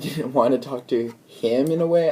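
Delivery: ordinary delivery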